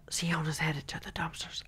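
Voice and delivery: hushed tip-off from behind a tree; whisper. Retold or imitated. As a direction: whisper it so she won't hear. Spotter relaying while everyone hides.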